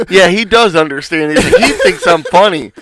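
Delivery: laughing quote. Retold or imitated. Retelling the boss chat, laughing quote. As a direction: crack up while quoting the boss. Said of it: "I was like".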